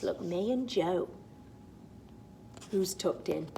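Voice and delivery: ordinary delivery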